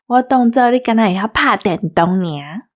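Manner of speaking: usual speaking voice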